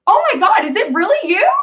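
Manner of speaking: high, excited gush of a star-struck fan. Retold or imitated. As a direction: play Priscilla meeting her idol, high and thrilled. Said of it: "And she goes".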